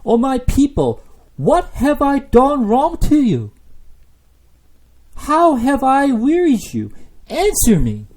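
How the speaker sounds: high, loud, slow pleading cry; God pleading. Acted. God asking his people, high slow pleading cry. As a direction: beg your people to answer. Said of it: "So God is saying".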